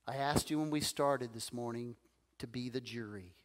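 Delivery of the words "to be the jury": whispered appeal to the jury; passionate closing argument. Real, not acted. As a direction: drop to a whisper, intimate.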